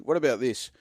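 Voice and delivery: normal talking voice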